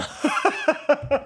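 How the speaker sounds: high burst of laughter; laughing. Real, not acted. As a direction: laugh it off happily. (éclat de rire)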